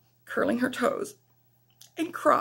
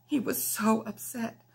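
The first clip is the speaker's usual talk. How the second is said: ordinary delivery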